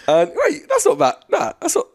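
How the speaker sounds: high, giggling protest; laughing it off. Real, not acted. Laughing to the co-host about the word, giggling.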